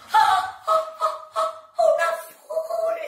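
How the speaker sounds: very high gasps into a drawn-out cry; amazed squealing. Acted. Amazed at the final reveal.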